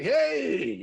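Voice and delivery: bright, loud, high hey; happy greeting. Retold or imitated. Clint Eastwood happily greeting the cameraman, excited. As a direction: greet an old buddy with joy. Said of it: "he was just like".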